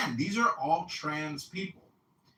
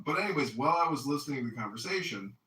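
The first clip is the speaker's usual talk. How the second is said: ordinary talk, nothing marked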